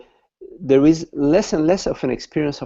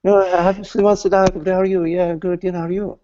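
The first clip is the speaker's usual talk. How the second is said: breezy reply; 8 semitones higher, faster than usual. Acted. Man answers friend, untroubled.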